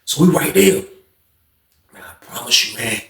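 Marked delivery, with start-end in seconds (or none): 1.9-3.0 whispered aside. Real, not acted. Suspenseful aside.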